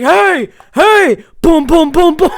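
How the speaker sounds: high, very loud shouts with banging noises; yelling to be let out. Retold or imitated. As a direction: shout and pound as if trapped. Imitates friend yelling and banging in trunk.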